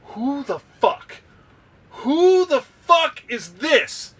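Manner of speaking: high, slow, drawn-out roar of an angry sergeant major; drill sergeant yell. Retold or imitated. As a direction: bellow it like a furious drill instructor. Sergeant major yelling at recruit.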